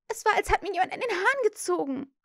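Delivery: put-on high, quick, startled girl; startled complaint. A narrator's reading. Wendy's hair was pulled, startled high complaint. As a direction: complain in a high rush after a shock.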